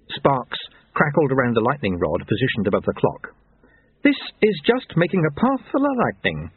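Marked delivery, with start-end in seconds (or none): 4.0-6.5 high, cheerful explaining; happy. A narrator's reading. Jeremy explains his lightning clock, happy and high. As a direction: explain it gleefully, pitch lifted. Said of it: "said Jeremy happily".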